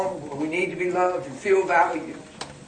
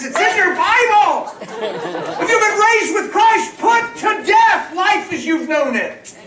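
full-force preaching; 7 semitones higher, 5 dB louder than usual. Real, not acted. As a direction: drive it home to the congregation, high and forceful.

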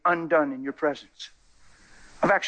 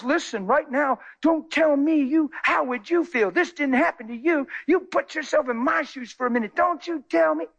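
higher-pitched, mildly indignant scolding; acted scolding, pitched up; indignant rebuke; 10 semitones higher than usual. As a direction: tell them off with rising insistence. Acted indignant rebuke from man of God.

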